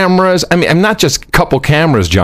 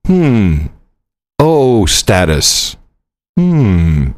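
slow, skeptical officer murmur; slow skeptical border guard; mock officer; 3 semitones lower, much slower than usual. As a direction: drag it out like a suspicious guard. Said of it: "they're like".

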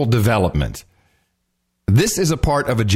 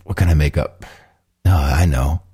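stoned producer; 7 semitones lower than usual. Retold or imitated. Host imitating the producer making up the claim, low and dopey. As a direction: drone it out like a stoned guy inventing a story. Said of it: "What was he, smoking dope".

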